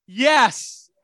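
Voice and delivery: a loud, beaming yes; emphatic yes with a smile. Shown as a demonstration. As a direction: say it with a big smile and full conviction. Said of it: "emphatically say yes with a smile".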